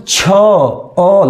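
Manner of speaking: छ stretched out into a long अ; drawn-out final vowel. Shown as a demonstration. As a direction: hold छ until its final अ rings clearly. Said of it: "last में क्या आता है?".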